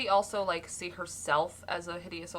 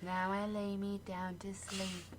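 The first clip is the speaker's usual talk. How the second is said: prayer; 2 semitones higher, 6 dB quieter, slower than usual. Pretend. Mock bedtime prayer.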